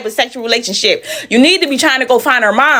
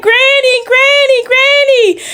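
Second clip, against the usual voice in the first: put-on high-pitched happy child calling Granny; little girl calling for her granny; excited little girl; much slower than usual. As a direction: squeal it like a small girl running down the stairs. Little girl running to grandma.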